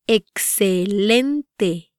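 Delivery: "Excelente" very slowly, syllable by syllable; "Excelente" drawn out for learners to copy; slow pronunciation demo. Shown as a demonstration. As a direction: stretch out every syllable of "Excelente". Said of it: "try pronouncing these words".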